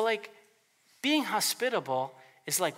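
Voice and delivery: ordinary talk, nothing marked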